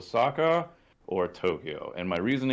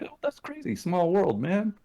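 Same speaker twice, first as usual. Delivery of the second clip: surprised; 11 semitones higher than usual. Retold or imitated. Retelling own surprised reply.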